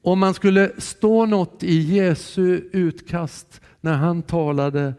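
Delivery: ordinary delivery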